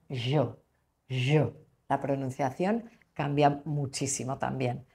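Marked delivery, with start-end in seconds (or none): 0.1-1.5 "yo" pronunciado "sho", con la Y rioplatense; "yo" con sonido sh argentino. Shown as a demonstration. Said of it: "y ellos dirán". ("yo" dicho a lo argentino, como "sho")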